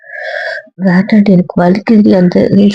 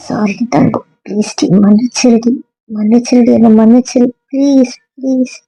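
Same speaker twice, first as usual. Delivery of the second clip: pleading apology; 4 semitones higher than usual. Real, not acted. Friend begs forgiveness after quarrel.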